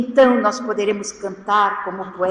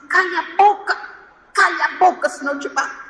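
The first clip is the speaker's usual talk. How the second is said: higher, louder mock scolding; agitated mother; 6 semitones higher, 5 dB louder than usual. Nervous mother scolds her crying girl at the mall, mock angry yell. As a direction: imitate a mother snapping at her crying child. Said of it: "uma senhora que estava muito nervosa".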